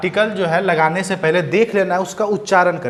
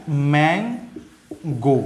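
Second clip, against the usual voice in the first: drawn-out model word; much slower than usual. Shown as a demonstration. Teacher modelling mango for the class, drawn out. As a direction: stretch mango out slowly, मैं-गो. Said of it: "ये क्या है".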